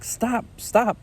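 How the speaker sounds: lightly squeaky stop, stop; a hint of the wife pleading; imitating wife. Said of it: "And she's like".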